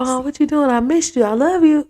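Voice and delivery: mimicking the clingy boyfriend's daily phone call, sugary and high; mock boyfriend sweet talk. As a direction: coo it like a smitten boyfriend.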